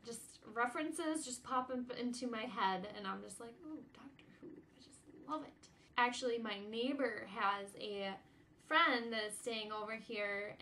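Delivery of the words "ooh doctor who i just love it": very soft, near-whispered sigh of delight; dreamy swoon. Retold or imitated. Fan swooning as Doctor Who pops into her head, hushed delight. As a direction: drop to a hushed, swooning aside. Acting out her own fond daydream.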